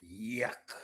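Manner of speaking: whispered disgust. Real, not acted. Tasting his cold drink, whispered yuck. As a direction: grimace at the taste.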